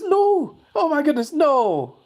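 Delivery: high, loud shocked cry of no; mock dismay. Reading a viewer's shocked chat message, high mock dismay. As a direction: squeal the chat's dismay way up high.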